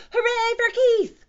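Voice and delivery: high, loud hooray; cheering cry. A narrator's reading. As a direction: cheer at full squeal. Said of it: "cried the cats".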